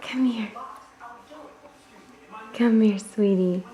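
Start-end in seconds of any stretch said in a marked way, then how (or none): 0.0-0.5 whispered coaxing call; whispering. Real, not acted. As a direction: whisper to lure her over.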